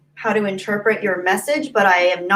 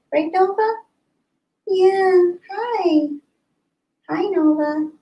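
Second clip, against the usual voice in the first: high sing-out greeting to a pet; much higher, cooing-style hello; pet talk; 10 semitones higher, slower than usual. Real, not acted. Streamer greeting Nova by the window, high pet talk.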